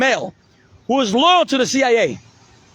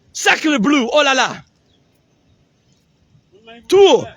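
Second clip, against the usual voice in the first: put-on over-the-top French accent; hammy French chef, loud outbursts; 4 semitones higher, 4 dB louder than usual. Mock French phrases, hammed up.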